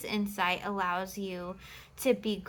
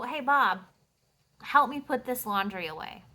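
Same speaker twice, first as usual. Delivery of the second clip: a confident, slightly louder ask to a partner; firm request; 3 semitones higher, 5 dB louder than usual. Shown as a demonstration. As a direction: hand over the chore like a clear instruction. Demo of delegating a chore.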